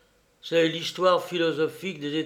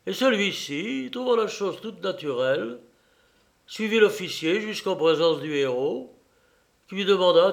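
usual speaking voice

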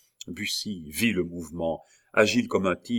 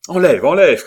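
urgent high, loud order; full-voiced urgent yell; shouted; 9 semitones higher, 13 dB louder than usual. A narrator's reading. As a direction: shout it as a desperate command. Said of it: "cria Bussy".

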